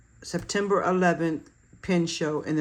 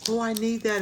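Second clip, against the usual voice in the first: high eager "oh I need that"; impulsive shopper; 7 semitones higher, 3 dB louder than usual. Retold or imitated. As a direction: gasp like you must have it. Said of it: "You can get in a trap of".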